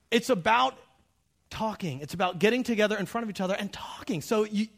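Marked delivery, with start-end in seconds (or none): none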